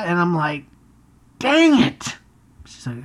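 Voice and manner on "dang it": high, squeaky mock frustration; comic high-pitched groan; frustrated dang it. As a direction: yelp it like you just got caught. Caught by the sales pitch.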